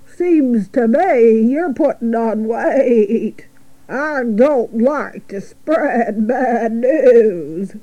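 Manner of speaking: slow, drawn-out sheep voice; unhurried drawl of an old ewe. Acted. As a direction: drag out the words as a gossipy old sheep. Sheep figure in story.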